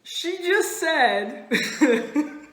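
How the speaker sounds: slow, high, through laughter; cracking up. Real, not acted. Teller reaching the punchline, cracking up. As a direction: fight real laughter to get it out.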